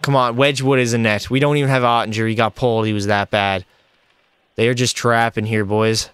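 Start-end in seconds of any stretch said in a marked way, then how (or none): none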